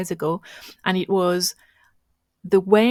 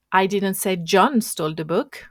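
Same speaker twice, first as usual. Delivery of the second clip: "John" punched, rising tune after it; stress on JOHN; 4 dB louder than usual. Shown as a demonstration. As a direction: lean on "John", as if it was someone else. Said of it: "the meaning is completely different".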